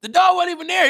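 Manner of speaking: high-pitched comic incredulity; mock disbelief. Comic reacting to a ridiculous excuse, mock disbelief.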